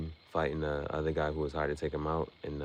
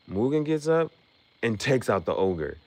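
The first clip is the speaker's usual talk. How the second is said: "Mugen gets up" jumps much higher and louder; excited outburst; 6 semitones higher, 5 dB louder than usual. Retold or imitated. Hyping up viewers as Mugen rises, loud and high. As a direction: shout it like the hero's comeback. Retelling the fight's climax.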